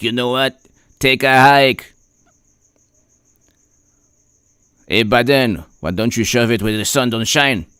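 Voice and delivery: a hint of a French accent, barely put on; lightly voiced French accent, half-hearted; bad French accent. France telling Biden off, in a weak mock French accent. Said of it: "horrible French accent".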